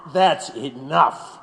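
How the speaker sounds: slow, firm that's enough; slow stern warning; stern cut-off. Acted. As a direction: shut him down. Interrogator cuts off prisoner.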